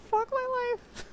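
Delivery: excited squeal. Real, not acted. Fangirling over voice.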